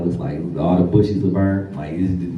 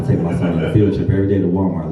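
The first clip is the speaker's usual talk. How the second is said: ordinary delivery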